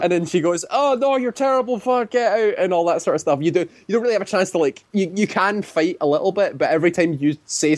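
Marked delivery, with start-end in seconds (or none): 0.7-2.5 high-pitched mock scolding; hammed-up telling-off in a high voice; mock chastising. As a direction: imitate a scolding councilor, pitched way up. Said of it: "chastises you the entire time".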